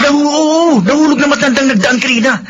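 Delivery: high, eager urging to grab the money; excited. Acted. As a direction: push your friend to snatch it fast.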